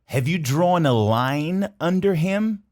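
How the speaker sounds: "Have you drawn a line under him?" said slowly, word by word; slow, clear demo. Shown as a demonstration. Teacher repeating the question slowly. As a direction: spell out the question for learners. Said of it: "Olha o que ele pergunta".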